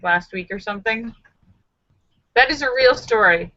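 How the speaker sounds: ordinary talk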